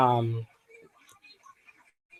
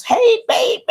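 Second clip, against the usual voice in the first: laughing shout. Real, not acted. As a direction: shriek a laughing greeting. Greeting viewer, laughing.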